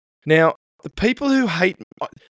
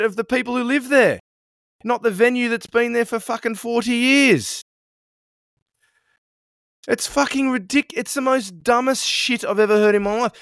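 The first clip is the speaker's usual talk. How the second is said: angry rant; 7 semitones higher than usual. Real, not acted. Ranting about councils.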